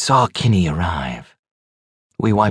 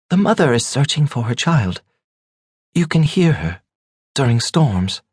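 higher voice with halting pauses; hesitant young wife; 8 semitones higher than usual. A narrator's reading. Younger wife resuming her ghost tale, high and hesitant. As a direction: tentatively offer it piece by piece. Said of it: "The younger wife spoke hesitantly again".

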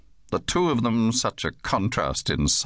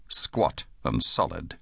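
ordinary delivery

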